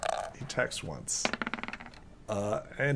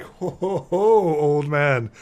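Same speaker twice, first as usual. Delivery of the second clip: raised "ho ho", swelling louder and drawn out; cocky teasing; 3 semitones higher, 8 dB louder, slower than usual. Acted. Dodging fighter to the old man, cocky teasing. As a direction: crow at a near miss, hammed-up. Fighter teases after dodge.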